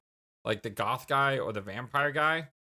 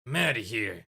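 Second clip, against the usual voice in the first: character voice. Acted. Character voice, timbre shifts.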